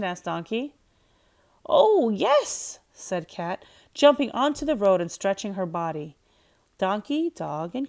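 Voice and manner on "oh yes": eager cat. A narrator's reading.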